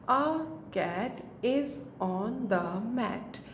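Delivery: the whole sentence very slow and even; slow, steady sentence read-aloud; smooth slow read. Shown as a demonstration. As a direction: read it through slowly for a child. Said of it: "इस तरह से बच्चा book reading करेगा".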